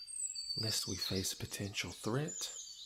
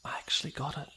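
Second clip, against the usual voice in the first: pleased higher gasp of disbelief; surprised; 6 semitones higher, much faster than usual. Acted. Princess surprised at hitting her target. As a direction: catch yourself off guard with the hit.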